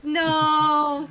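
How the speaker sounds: loud sharp protest; shouted no. Real, not acted. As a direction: yell out a quick no. Reacting to K-pop joke.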